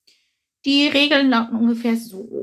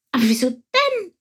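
high, surprised question from the sparrow; a sparrow calls out in surprise; astonished cry; 6 semitones higher than usual. A narrator's reading. Sparrow Chilp calls out in astonishment to the beetle. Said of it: "rief Chilp erstaunt".

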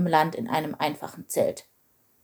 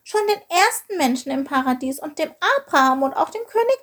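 high, tearful little voice; sobbing protest; 10 semitones higher than usual. A narrator's reading. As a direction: plead through mock sobs, pitched high. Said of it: "schluchzte er".

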